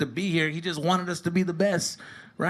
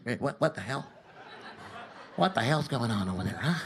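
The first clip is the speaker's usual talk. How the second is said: put-on lower, softer country-accented ref; 6 semitones lower, 7 dB quieter than usual. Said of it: "redneck cowboy refs with that accent".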